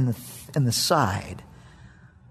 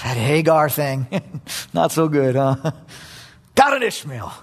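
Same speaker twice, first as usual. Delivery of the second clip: usual speaking voice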